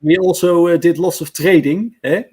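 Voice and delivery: loud, high Dutch-accented pirate trader. Shown as a demonstration. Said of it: "I can do the Dutch, uh, accent".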